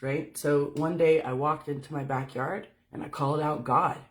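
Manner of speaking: ordinary talk, nothing marked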